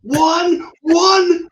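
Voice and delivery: very high, loud full shout; acted sergeant's scream; screaming drill sergeant. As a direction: bellow it at the whole formation. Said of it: "He's screaming at everybody".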